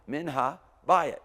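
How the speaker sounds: slow loud emphasis on habayit; stress on habayit. Shown as a demonstration. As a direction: lean hard into habayit, slowly. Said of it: "Hear the accent there?".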